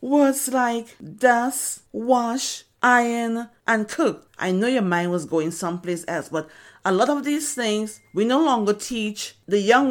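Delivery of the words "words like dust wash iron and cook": slow punchline. Acted. Punchline of the bride joke.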